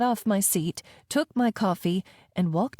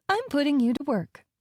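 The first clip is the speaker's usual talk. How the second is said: higher, bright mock-cheerful; chirpy; 7 semitones higher than usual. A narrator's reading. Nanny chirps to the sulky girl, mock-cheerful. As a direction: chirp it like good news. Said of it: "I chirped".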